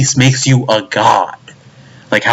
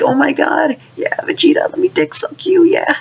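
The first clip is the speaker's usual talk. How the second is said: very high, squeaky fanboy gush; mocking gushing fans. Mocking people who worship Vegeta, squeaky gushing voice. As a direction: squeal in mock adoration.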